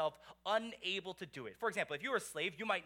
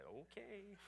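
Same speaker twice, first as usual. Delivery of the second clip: timid okay; 2 semitones higher, 14 dB quieter than usual. Retold or imitated. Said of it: "And you say".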